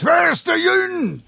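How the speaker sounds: high, angry dialect shout; angry outcry. Acted. Furious uncle demanding the onion, angry dialect shout. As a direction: bellow it at the boy in dialect. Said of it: "riep hij kwaad uit".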